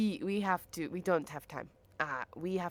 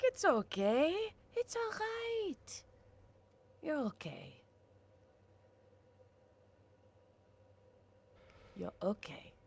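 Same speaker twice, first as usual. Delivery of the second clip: soothing; 10 semitones higher, 7 dB quieter than usual. Acted. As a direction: hush a crying child gently. Soothing the crying character.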